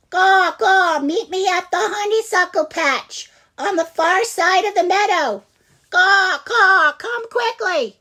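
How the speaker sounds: high, loud cawing mother jay; fake Missus Blue Jay. Ziggy fakes the mother jay's call to trick the twins, high and loud. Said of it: "In his best fake Missus Blue Jay voice".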